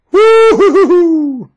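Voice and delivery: high, slow, loud hooting whoop; playful whoop. Acted. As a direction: let out a cartoon space-travel whoop. Imitating Pluto's whoop.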